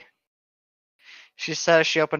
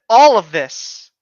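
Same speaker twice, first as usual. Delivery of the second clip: drawn-out and louder; slow emphatic; 3 semitones higher, 7 dB louder, slower than usual. Real, not acted. Friends griping about the plot, slow emphatic. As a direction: lean on each word slowly.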